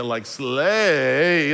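slay stretched long with the vowel held out; drawn-out slay. Shown as a demonstration. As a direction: drag out slay like a teen daughter. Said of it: "six more A's in it".

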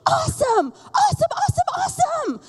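put-on high, excited child shouting; mock excited child's shout; excited preschooler. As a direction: squeal it like a thrilled little kid. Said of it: "he's like".

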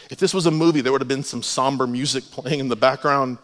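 ordinary delivery

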